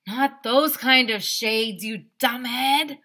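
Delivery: drawn-out know-it-all scorn; slow scornful correction. Acted. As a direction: Ryan correcting the narrator, slow and loud. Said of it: "said Ryan".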